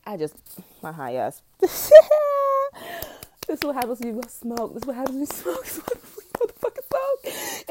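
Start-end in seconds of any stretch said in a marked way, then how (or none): 1.6-3.4 loud, high-pitched burst of laughter; laughing outburst. Real, not acted. High podcaster cracks up at themself, laughing outburst. 3.5-7.7 high, loud words through real laughter; laughing. Real, not acted. High podcaster laughs at what smoking does, laughing. Cracking up about being high.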